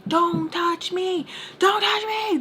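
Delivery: high, drawn-out warning cry; retold outcry. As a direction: reenact a frantic high-pitched protest. Retold panicked confrontation at door.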